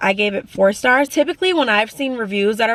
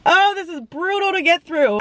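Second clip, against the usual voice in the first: tearful; 9 semitones higher than usual. Real, not acted. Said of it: "These are happy tears".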